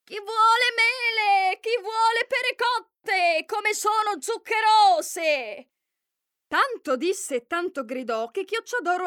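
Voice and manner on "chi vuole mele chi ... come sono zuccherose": high, loud, slow drawn-out hawking call; street vendor's cry. Acted. Disguised queen hawks fruit to lure the girl, long loud calls. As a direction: sell your fruit to the whole mountain. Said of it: "cominciò a gridare".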